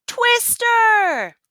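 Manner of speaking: high, loud alarmed shout. A narrator's reading. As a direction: scream the warning across the saloon. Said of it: "he heard a shout".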